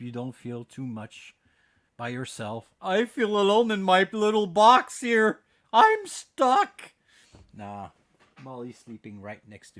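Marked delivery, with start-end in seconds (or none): 2.8-6.9 mock lonely whine. Joking about being alone.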